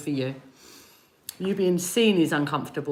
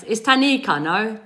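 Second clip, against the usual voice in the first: ordinary delivery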